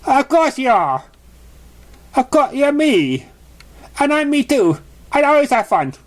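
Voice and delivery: high and eager, every line bouncing; high hyper pony voice; bubbly pony. Acted. Excited pony character dialogue.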